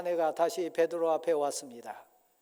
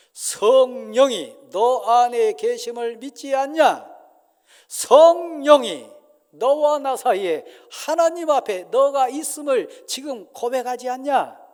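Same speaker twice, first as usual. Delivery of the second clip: much louder, higher, slow stern challenge; thundering rebuke; 6 semitones higher, 14 dB louder than usual. Acted. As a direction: hammer the question home. Peter's climax of rebuke. (베드로가 격하게 크게 꾸짖음)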